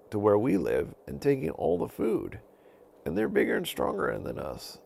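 a wolf complaining of bullies; worried wolf. Acted.

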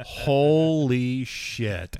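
very slow, drawn-out amazement; stunned. Retold or imitated. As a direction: stretch each word in disbelief. Retells own stunned reaction.

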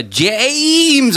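mimicking a long sung name; stretched-out name. Coworker asks after the software, name stretched mockingly. Echoes his drawn-out name.

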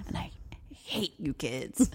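a hint of a weary mother's muttered grumble; under the breath. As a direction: mutter it under your breath while washing dishes. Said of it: "say under her breath".

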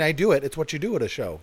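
ordinary talk, nothing marked

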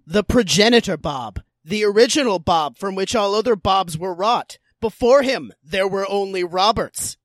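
hammed-up epic origin telling; grandiose mock epic. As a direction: proclaim it like ancient lore. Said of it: "I gotta get this delivery right".

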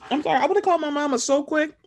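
ordinary talk, nothing marked

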